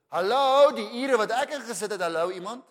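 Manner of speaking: loud, high calling out to be noticed; mock frustrated call. Overlooked worker calling out, ignored. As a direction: shout for someone to notice.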